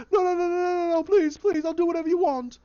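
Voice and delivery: very high, loud rapid-fire pleading; frantic begging. Retold or imitated. Captive begging the boss for life, frantic. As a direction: beg for your life in shrieking panic.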